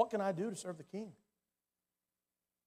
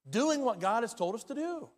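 ordinary delivery

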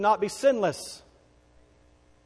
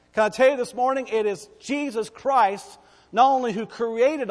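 higher, louder, emphatic preaching; forceful preaching; 5 semitones higher, 4 dB louder than usual. Real, not acted. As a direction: build to a ringing declaration for the congregation. Preaches climax to congregation.

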